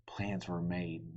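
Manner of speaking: ordinary delivery, nothing marked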